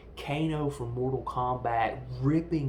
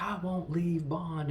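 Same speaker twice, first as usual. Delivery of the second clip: higher-pitched imitation of a protest; put-on protest, pitched up; mock protest; 6 semitones higher, 3 dB louder than usual. Woman refusing to leave the hero behind, mock protest.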